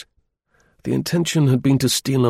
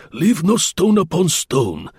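slow, high, raised commanding voice; 8 semitones higher, slower than usual. A narrator's reading. Gourdon commanding the search party, forceful and slow. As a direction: drag each word out as an order. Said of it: "he commanded".

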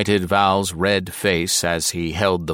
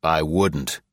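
ordinary delivery

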